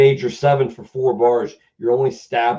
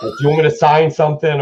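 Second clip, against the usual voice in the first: put-on Boston accent; 5 semitones higher, 3 dB louder, faster than usual. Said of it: "such a thick Boston accent".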